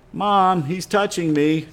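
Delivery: put-on whiny kid tattling to mom; whiny tattling little sibling. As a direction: whine it like a tattling little brother. Kid tattling joke after rule.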